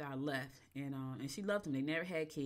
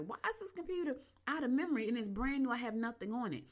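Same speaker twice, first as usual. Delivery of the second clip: higher, whiny mock complaint; exasperated complaint; 7 semitones higher than usual. Griping that the new laptop has no memory, high exasperated whine. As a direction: whine about the brand new computer being full.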